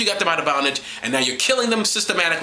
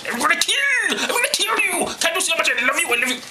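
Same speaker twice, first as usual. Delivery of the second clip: much higher, sing-song psycho love; mock unhinged God; 10 semitones higher than usual. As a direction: coo love while threatening to kill.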